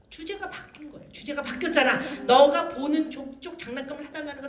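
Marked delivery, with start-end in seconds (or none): none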